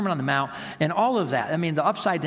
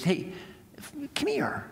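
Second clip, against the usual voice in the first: gentle call; 7 semitones higher, 6 dB quieter than usual. Retold or imitated. Jesus calls the little boy.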